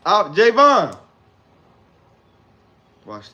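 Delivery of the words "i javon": shout. Real, not acted. Shouting his own name.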